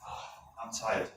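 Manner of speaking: slow, breathy mock-tired sigh of a man coming home; mock weary. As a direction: drag it out like you're worn out after work. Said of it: "I'll say pretty much".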